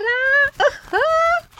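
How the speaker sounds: high-pitched character laugh. A narrator's reading. Friends react to macaw's arrival.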